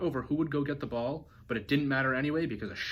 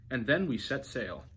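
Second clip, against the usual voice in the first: plain reading voice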